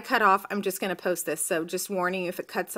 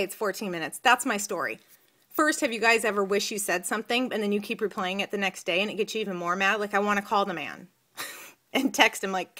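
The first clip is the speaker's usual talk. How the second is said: ordinary talk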